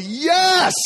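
a high, loud whoop of victory; triumphant yes. Retold or imitated. Man lands the jump and cheers, high triumphant yell. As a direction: shout it like you just stuck the landing. Landing on his own balcony.

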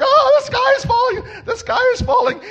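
high, loud shriek of alarm; hammed-up frantic squawking; mock panic. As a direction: run around screaming in storybook panic. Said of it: "he made such a panic".

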